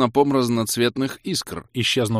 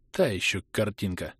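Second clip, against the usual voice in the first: ordinary delivery, nothing marked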